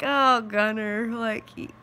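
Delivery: slow, drawn-out mock amusement; sarcastic. Mom dryly answering her son's joke, slow sarcasm. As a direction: roll your eyes through it, slowly.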